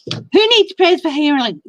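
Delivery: upbeat call-out. Real, not acted. Host's energetic prompt.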